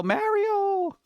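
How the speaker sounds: high, sustained call of "Mario" with a flat American A; held high-pitched mock shout, no real volume; mock yell. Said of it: "How many times do I have to yell".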